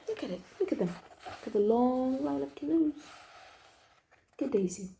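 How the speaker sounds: ordinary delivery, nothing marked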